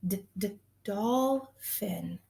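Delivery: slow, stretched d sound then dolphin. Shown as a demonstration. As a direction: sound out the d, slowly. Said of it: "there's a D at the beginning".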